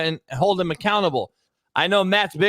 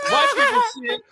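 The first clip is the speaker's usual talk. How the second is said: squealed repeat. Real, not acted. Repeats phrase higher.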